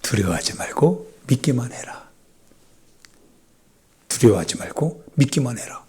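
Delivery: whispered, a silent cry to yourself; breathed inner shout; inward whisper. Shown as a demonstration. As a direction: whisper it like a prayer inside. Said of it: "속으로 외쳐봅시다".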